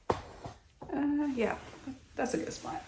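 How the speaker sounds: soft unvoiced whisper. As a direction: hush it close to the mic. Whispering close to camera.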